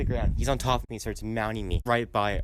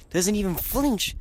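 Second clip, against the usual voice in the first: incredulous outburst; 9 semitones higher than usual. Real, not acted. Indignant climax of tackle story.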